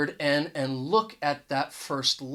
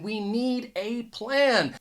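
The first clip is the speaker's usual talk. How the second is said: slow and higher, "a" as AY before "plan"; important, emphatic; 5 semitones higher, slower than usual. Acted. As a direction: spell out the need slowly, "a" said "ay" in "a plan". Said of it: "This is very important".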